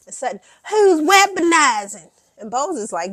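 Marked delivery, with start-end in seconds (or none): 0.7-2.1 indignant yell. Retold or imitated. Sutton's retold defensive outburst.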